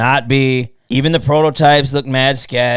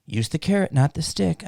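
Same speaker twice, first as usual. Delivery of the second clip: softer, lightly imitated motherly advice; quoting mom; 3 semitones higher, 7 dB quieter than usual. Quoting his mom's advice to him, lightly imitated. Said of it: "Like my mom used to tell me".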